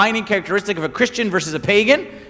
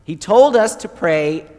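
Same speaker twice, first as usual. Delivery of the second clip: ordinary delivery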